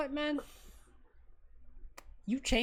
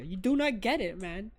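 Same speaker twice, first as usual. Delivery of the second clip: usual speaking voice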